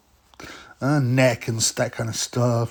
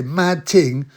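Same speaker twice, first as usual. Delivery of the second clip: mock Roadman; 4 semitones higher, 3 dB louder, slower than usual. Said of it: "That's what he say".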